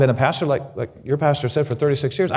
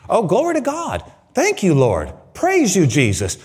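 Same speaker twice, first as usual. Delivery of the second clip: big excited praise; 5 semitones higher than usual. Retold or imitated. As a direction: let the praise burst out joyfully. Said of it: "you get bigger and bigger".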